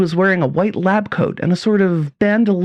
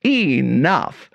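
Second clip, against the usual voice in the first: plain reading voice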